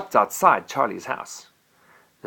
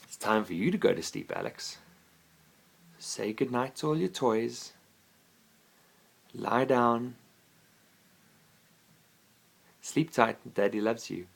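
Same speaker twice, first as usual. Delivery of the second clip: a dad tucking his son in, hushed; soft goodnight; 7 dB quieter than usual. Real, not acted. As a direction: lull him to sleep gently. Father settling child to sleep.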